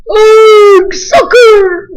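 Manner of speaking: high, loud crew jeer; the whole crew yelling it back; rowdy pirates echoing the taunt; yelled. A narrator's reading. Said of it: "the crew yelled".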